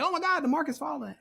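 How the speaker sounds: high, fast mock panic; hammed-up alarm at a falling market. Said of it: "and you're like".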